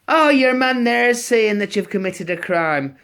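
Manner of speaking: put-on young Garda. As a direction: send up the rookie officer. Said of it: "he goes".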